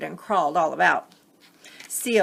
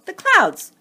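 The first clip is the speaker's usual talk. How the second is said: much higher and louder, delighted; excited answer; 13 semitones higher, 10 dB louder than usual. Acted. Bowie answers Gull about the clouds, excited. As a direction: burst out with the answer.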